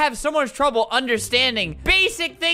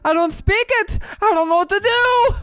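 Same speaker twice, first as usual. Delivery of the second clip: mock confused player; 7 semitones higher than usual. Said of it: "He continues on in his own language".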